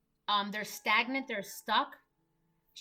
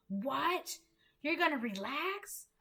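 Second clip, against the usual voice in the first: mock outrage, higher and building louder; incredulous outrage; 5 semitones higher, 5 dB louder than usual. Grinder looking down on people who relax, mock-incredulous outrage. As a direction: imitate a scandalized workaholic, piling up disbelief.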